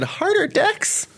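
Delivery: high, breathy-free mock sultry plea; mock sexual. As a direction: make it sound sexual on purpose. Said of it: "Especially when you said".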